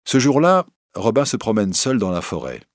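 ordinary delivery

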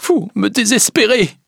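high, tearful outcry; distraught wail; sobbing despair. A narrator's reading. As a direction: cry out in despair through tears. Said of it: "en sanglotant".

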